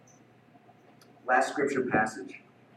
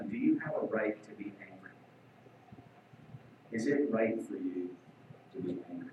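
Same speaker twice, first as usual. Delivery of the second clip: softer, voicing God gently asking; gentle quiet question; 8 semitones lower, 11 dB quieter than usual. God gently asks angry Jonah, soft and quiet. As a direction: ask it quietly, like a patient father.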